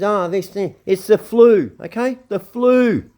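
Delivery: high, loud, patronising impression of an official; mock official. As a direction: brush it off like a smug bureaucrat. Mocking officials dismissing diseases.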